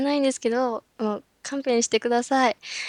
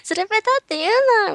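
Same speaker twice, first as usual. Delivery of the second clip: mock shout; 8 semitones higher than usual. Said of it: "結構叫んだりしてます".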